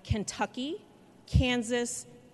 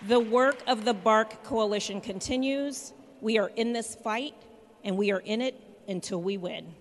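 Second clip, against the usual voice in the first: rally speech. Real, not acted. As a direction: rouse the crowd, punch each line. Rally cry ending a speech.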